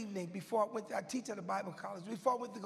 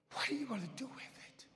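softer, quick and whispered; intense whisper; 6 dB quieter, faster than usual. Acted. Preacher pressing his congregation, urgent whisper. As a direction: lean in and hiss the question.

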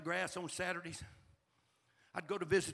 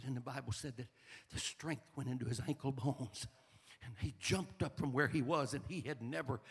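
usual speaking voice

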